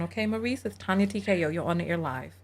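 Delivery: ordinary talk, nothing marked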